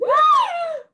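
a huge high shocked gasp; loud gasp. A narrator's reading. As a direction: gasp in horror. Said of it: "Billy gasped".

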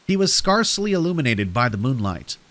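ordinary delivery

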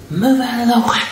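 high, tight mock yell; retold angry order. Mother pushes through the crowd to the girl, retold angry order. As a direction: re-enact a furious push through the crowd.

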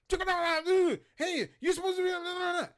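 high, loud mock yell at him; mocking coworker yelling. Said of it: "He keeps yelling at me".

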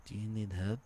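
a mock [name] murmur with an accent; low [name] voice. Man at Home Depot imitated with a low [name] voice and accent. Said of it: "all like the low [name] voice".

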